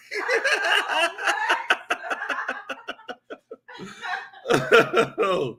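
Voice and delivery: long, high helpless laugh; cracking up. Real, not acted. Cracks up at a viewer's comment.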